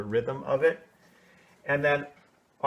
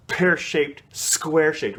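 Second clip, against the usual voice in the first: "Pear-shaped, square-shaped" with the P and the SQU popped out higher and louder; crisp consonants; 4 semitones higher, 4 dB louder than usual. Shown as a demonstration. Teacher shows how to hit the consonants in pear-shaped. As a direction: punch the squa in "square-shaped". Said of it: "Really get that squa in there".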